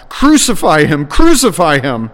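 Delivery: loud, insistent cry; crowd crying out. A narrator's reading. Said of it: "they cried out".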